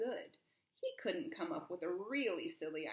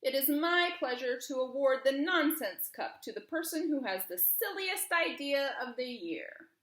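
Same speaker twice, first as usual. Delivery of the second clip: booming royal announcement, higher and louder; king's address; 5 semitones higher, 7 dB louder than usual. Acted. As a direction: call out to the whole square. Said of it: "he said to the crowd".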